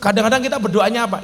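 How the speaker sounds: ordinary talk, nothing marked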